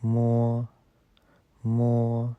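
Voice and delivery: मो said as a clear mo; मो, the Chinese m sound. Shown as a demonstration. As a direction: hum into मो and open. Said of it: "pronounce करते हैं".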